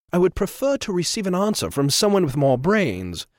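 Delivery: smooth, superior intervention; fussy gentleman. Acted. Trevor voiced.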